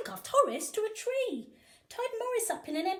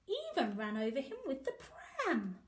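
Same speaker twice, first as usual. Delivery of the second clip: ordinary delivery, nothing marked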